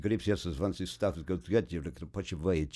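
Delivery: put-on improvised gibberish in a Russian accent; fake Russian. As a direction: bluff your way through mock Russian. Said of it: "just fake it".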